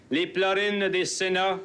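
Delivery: usual speaking voice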